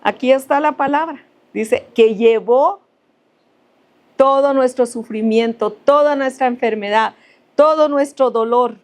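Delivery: preaching. Acted. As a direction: build the list as you preach. Preaching, building on the verse.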